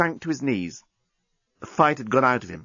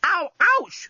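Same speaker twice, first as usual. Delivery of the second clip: much higher pained yelp; yelled in pain. Acted. The journalist to Lucy pressing his wound, high pained yelp. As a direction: yelp as she hurts your wound. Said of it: "the journalist yelled".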